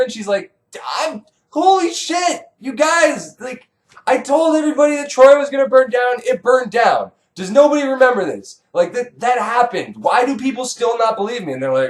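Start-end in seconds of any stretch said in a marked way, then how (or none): 1.0-11.5 high-pitched exasperated outburst; pissed-off prophetess. Acted. Doomed prophetess pleading to be believed, exasperated. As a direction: rant shrilly at people who won't listen. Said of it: "she's really pissed off".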